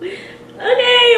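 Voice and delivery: very high, drawn-out okay after a laugh; awkward okay. Retold or imitated. He offers his number, awkward high okay.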